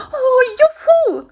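high, loud whoop of delight; gleeful little character whooping; overjoyed cheer. A narrator's reading. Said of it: "freute sich Bisi".

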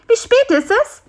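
very high, loud little fly's voice; high-pitched insect asking. A narrator's reading. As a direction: ask urgently in a tiny high voice.

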